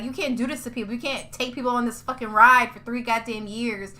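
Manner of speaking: ordinary talk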